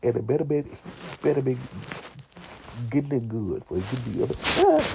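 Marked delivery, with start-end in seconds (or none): 4.1-4.8 very high, loud mock moan; a hammed-up squeal. Imitating the noisy love scene, a high squeal.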